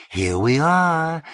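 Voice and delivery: high, slow, bright cry of arrival; cried out. A narrator's reading. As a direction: sing it out as she sweeps back in. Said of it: "Missus Richards cried".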